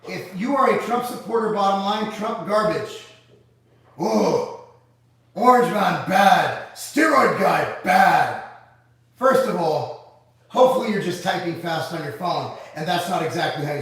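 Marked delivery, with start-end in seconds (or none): 4.0-8.4 louder, slow, dumbed-down sneer; mocking caveman talk. Streamer mocking a commenter's talking points, louder dumb sneer. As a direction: parody a simple-minded slogan.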